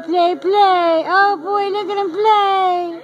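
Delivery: high, slow cooing at puppies. Real, not acted. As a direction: coo over the playing puppies.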